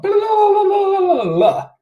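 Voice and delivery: high, loud playful blahs; squeaky mock sign chant; sing-song blah. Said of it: "I look like a fool".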